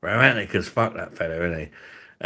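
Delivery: ordinary talk, nothing marked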